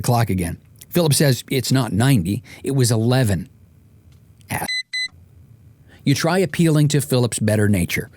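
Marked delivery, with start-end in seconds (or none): none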